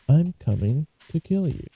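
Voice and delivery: soft, menacing creep voice; creepy killer. Acted. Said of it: "He looks so creepy".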